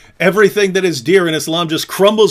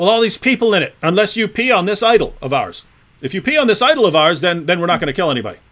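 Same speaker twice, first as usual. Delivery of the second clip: mock tribe leader; 5 semitones higher, 5 dB louder than usual. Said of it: "they say".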